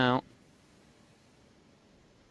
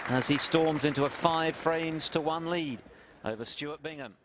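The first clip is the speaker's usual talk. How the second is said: raised commentary; 6 semitones higher than usual. Real, not acted. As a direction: lift above the cheering crowd. Commentating over the century applause.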